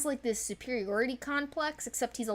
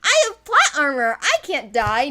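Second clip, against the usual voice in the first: higher and louder, smug and teasing; cocky quote; 6 semitones higher, 11 dB louder than usual. Retold or imitated. Quoting the daughter's smug boast, higher and louder. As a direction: brag like you're untouchable. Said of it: "And he's like".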